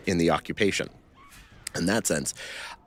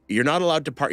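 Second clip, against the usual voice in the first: mild mock yell, pitched higher; retold soldier's yell; 7 semitones higher, faster than usual. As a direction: imitate a soldier barking orders, half-hearted. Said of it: "he's screaming in Hebrew at him".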